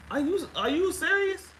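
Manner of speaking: high, disbelieving "are you serious"; incredulous. Retold or imitated. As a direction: sound stunned that he could act this way. Disbelief at his careless reaction.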